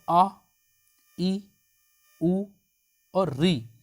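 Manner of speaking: each vowel clipped short with a pause after it; short vowel demo. Shown as a demonstration. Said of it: "इनमें आपको बहुत कम समय लग रहा है".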